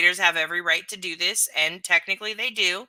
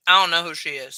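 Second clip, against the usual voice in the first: talking while chewing ice; words around crunching ice; 6 dB louder, faster than usual. Real, not acted. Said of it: "I'm chewing ice".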